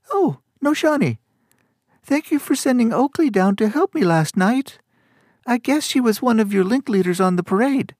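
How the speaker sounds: very high-pitched character voice, grateful; startled young corps leader. Acted. Flustered corps leader thanks her fellow leader for help, high-pitched and eager. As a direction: thank a superior in a tiny, very high voice.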